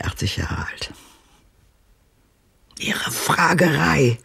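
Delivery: worn-out whispered scorn; bitter whisper. Acted. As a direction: breathe it out bitterly. Old woman, bitter and tired.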